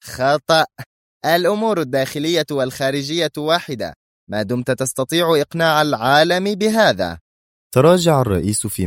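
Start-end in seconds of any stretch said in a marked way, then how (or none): 0.0-7.2 high-pitched, emphatic correction; insistent, raised-pitch rebuttal; forceful contradiction. A narrator's reading. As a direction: overrule the minister with conviction. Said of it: "هزت رأسها في قوة قائلة".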